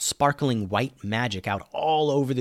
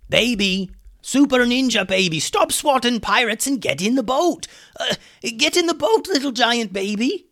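very high, loud character shouting; calling for attention; 12 semitones higher, 5 dB louder than usual. A narrator's reading. Hummus tries to get giant baby's attention, shouting. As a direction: frantically holler up at a giant baby who won't listen. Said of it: "was trying to get Lucas's attention".

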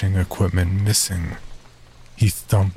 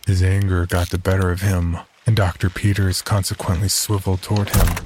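usual speaking voice

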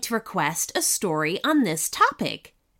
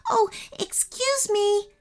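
hurried; 10 semitones higher than usual. Acted. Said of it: "said Ananya hurriedly".

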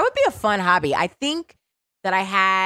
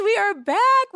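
high, hyped announcement; excited; 14 semitones higher than usual. Real, not acted. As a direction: sell the return. Repeated hype opening.

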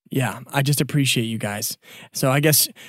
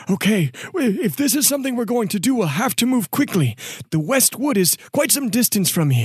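higher, hurried character voice; Dwoot, officious informant; 8 semitones higher than usual. Acted. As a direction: urge them along with fussy urgency. [name], uh, chimes back in.

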